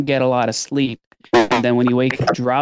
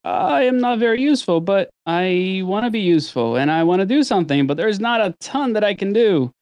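higher, earnest inner voice; helpless new dad; 8 semitones higher than usual. Retold or imitated. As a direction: sound eager but powerless. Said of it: "type of times where it's like".

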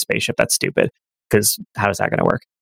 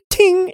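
ting. Acted. Imitated deflection.

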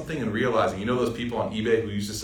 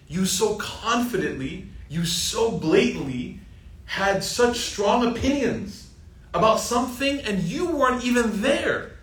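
higher, slow, rising disbelief; incredulous rise; 9 semitones higher, slower than usual. Acted. Preacher mocking deniers who judged without being there, higher and slow. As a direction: stretch each word up in mock disbelief.

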